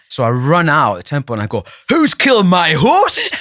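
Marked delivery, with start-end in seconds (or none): 1.9-3.3 loud, high, drawn-out Geordie yell; hammed-up Geordie cry to the camera; broad Geordie shout. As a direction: bellow it slowly in thick Geordie. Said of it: "in really broad Geordie".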